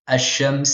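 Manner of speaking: ash-shams, l swallowed into a long sh; assimilated article. Shown as a demonstration. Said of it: "on ne dit pas l, on dit".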